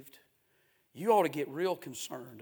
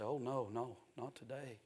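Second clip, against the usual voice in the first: soft refusal; 9 dB quieter than usual. Acted. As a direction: quietly brush off the invitation. Voices people refusing God.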